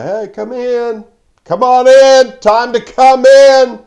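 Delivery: high, loud call across the yard; father calling out. Retold or imitated. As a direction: call the kids in from the back door. Father calling kids inside.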